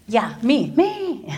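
high, squeaky me, me; eager volunteering. Retold or imitated. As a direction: jump at the chance, hand up.

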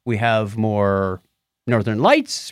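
normal talking voice

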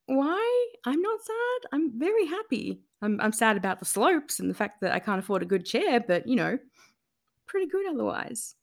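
high, bright disbelief; incredulous retort. Retold or imitated. Replying to the pitying stranger, high incredulous disbelief. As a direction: answer in amused disbelief.

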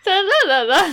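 high, loud nonsense babble; playful gabbling over the listener; mock chattering. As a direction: imitate someone who keeps rambling. Mimicking someone chattering on.

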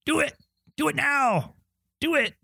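high-pitched imitated yelling, held back in volume; mock yell. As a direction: imitate urgent shouted commands without real loudness. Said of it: "when he's yelling at Julia".

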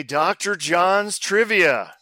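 slow, stretched title announcement; game-show announcement. Acted. Host launching the quiz, announcer-style.

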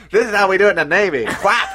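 loud, fast jokey navy bravado; hammed-up sailor voice. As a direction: bluster it like a cocky sailor. Acted sailor scene, joking.

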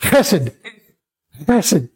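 Chesed run together from a throaty kh; Chesed as one word, raspy first sound; quick guttural chesed. Shown as a demonstration. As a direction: scrape the opening of Chesed and go straight into -esed. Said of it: "Now do it quickly".

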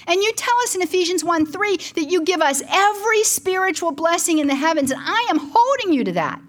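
high, impassioned demand to God; retold screaming. Grieving mother holding the Lord to Ephesians, retold screaming plea. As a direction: relive a desperate demand, pitched high without full volume. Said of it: "I am screaming this in the bedroom".

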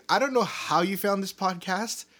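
ordinary talk, nothing marked

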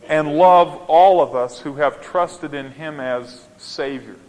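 normal talking voice

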